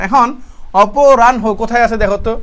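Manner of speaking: ordinary talk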